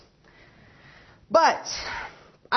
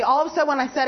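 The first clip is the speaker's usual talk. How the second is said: ordinary talk, nothing marked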